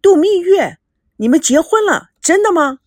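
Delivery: high, loud cries of shock; 大吃一惊地追问. A narrator's reading. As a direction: blurt out each question in disbelief. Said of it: "赵熙大吃一惊".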